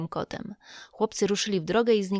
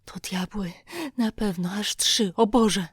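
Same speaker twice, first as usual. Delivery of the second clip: terrified whisper. A narrator's reading. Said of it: "Hak szepnął z przerażeniem".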